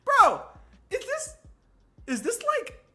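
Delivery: high, loud disbelieving bro; stunned realization. Real, not acted. Streamer realizing the [name] parallel, high and stunned. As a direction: dawn on it in shock.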